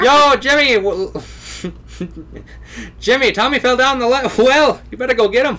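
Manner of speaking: high-pitched laughing call. Pretend. Voicing a calling game character.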